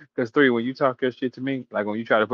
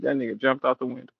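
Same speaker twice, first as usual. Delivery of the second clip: ordinary talk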